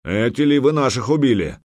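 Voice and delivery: loud grim viking vow. Acted. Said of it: "нахмурился медвежья лапа".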